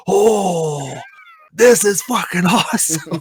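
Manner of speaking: high, drawn-out gasp into a thrilled line; awed excitement. Retold or imitated. Viewer reliving the bloodbending reveal, awed excitement. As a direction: breathe out a long high "oh", then gush. Retells awed reaction to the show.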